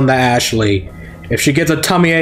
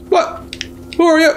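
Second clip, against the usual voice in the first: high startled voice; mock startled character. Player voicing the startled game hero, high pitched. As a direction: play the confused hero, high.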